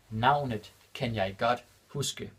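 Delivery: Navnet kan jeg godt huske said slowly, word by word; slow it down for the learners; slow and clear. Shown as a demonstration. Teacher repeating the Danish example for learners, slow and clear. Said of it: "repeated slower for learners".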